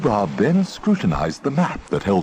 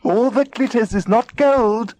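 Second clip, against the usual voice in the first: high, squeaky bird chorus; squeaking birds; 3 dB louder than usual. A narrator's reading. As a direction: chirp it like little birds. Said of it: "squeaked the birds in chorus".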